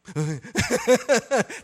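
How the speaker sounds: loud high burst of laughter; hearty laugh. Real, not acted. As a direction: let a big laugh out. Laughs at husband's promise. (高声大笑)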